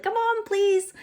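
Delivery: high, begging plea in an odd put-on voice; pleading. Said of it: "So you're like".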